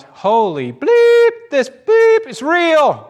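high, loud, drawn-out astonished yell. Retold or imitated. Newcomer Dennis reacting to a healing, yelling out. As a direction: shout it with long, stretched words. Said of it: "And Dennis yells out".

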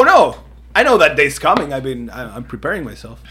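ordinary delivery

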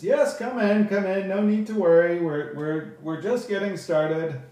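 stagey high elf host; high elf speaker. Acted. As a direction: welcome latecomers in a high, projected voice. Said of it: "the high elf on stage says".

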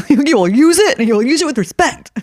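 mock-stern mother. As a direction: lay down the law with comic firmness. Mock-stern mother demanding her title.

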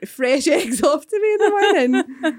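high and loud through real laughter; laughing disbelief. Real, not acted. Podcaster explaining the fresh eggs to co-hosts, laughing disbelief. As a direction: crack up while insisting on it. Laughing over the absurd story.

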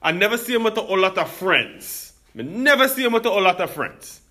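high, thick Jamaican accent imitating the barber; imitating a Jamaican barber's thick accent. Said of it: "a very thick Jamaican accent".

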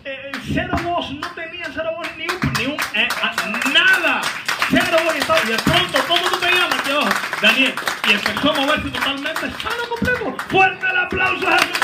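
high, loud preaching at full force; impassioned preaching. Real, not acted. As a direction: shout the miracle up to the applause. Preacher builds miracle story to applause. (prédica exaltada a gritos)

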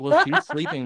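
squeaky high sarcastic voice; high mock sarcasm. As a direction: jump way up high, mocking. Sarcastic reply to the intruder.

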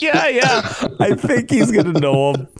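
high, laughing sarcastic agreement; mock-serious yeah yeah. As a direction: wink at the joke while agreeing. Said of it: "I'm like".

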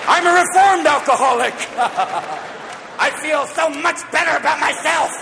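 high-pitched, over-bright proclamation; manic cheer. Acted. Reformed alcoholic at Betty Ford, high manic insistence. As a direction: push the recovery far too hard.

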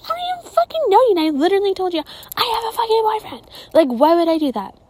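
high, fast acted outrage; rapid, high-pitched retold telling-off; indignant comeback. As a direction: rattle it off high-pitched, scandalized at the stranger. Acting out rejecting the pushy stranger.

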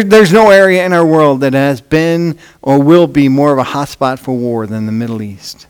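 usual speaking voice

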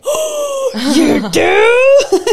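high, loud laughing surprise; surprised shout. Retold or imitated. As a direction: squeal it out in delight. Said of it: "And I was like".

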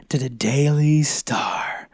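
slow stage whisper. Acted. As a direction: breathe the name in a hushed whisper. Repeats name in whisper.

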